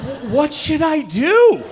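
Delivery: slow, high, bewildered laughing question; stunned wife. Retold or imitated. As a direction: ask it slowly in disbelief. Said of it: "she's like".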